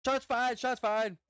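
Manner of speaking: imitating British cop. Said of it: "That's what that guy sound like".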